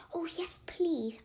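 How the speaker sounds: eager plea. Acted. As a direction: beg eagerly for another sweet. Eager for another sweet.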